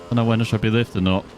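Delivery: ordinary talk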